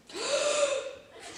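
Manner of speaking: high mock gasp with a laugh; hammed-up gasp. Narrator performs the army's gasp in a high mock gasp. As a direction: gasp like a shocked crowd, playfully. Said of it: "the whole army gasped".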